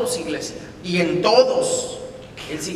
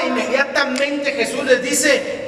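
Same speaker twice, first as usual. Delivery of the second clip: preaching at full force; 7 semitones higher, 3 dB louder than usual. Real, not acted. Preacher proclaiming to the church that Jesus calms storms. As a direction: drive the promise home to the congregation.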